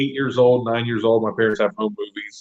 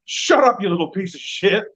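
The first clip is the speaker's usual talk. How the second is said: mock heel snap; 6 semitones higher than usual. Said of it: "he looks down and goes".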